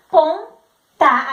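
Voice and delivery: "Apontar" drawn out, the final "tar" held long; slow sounding out. Shown as a demonstration. Teacher acting the child sounding out "Apontar". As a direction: stretch it slowly. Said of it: "com A".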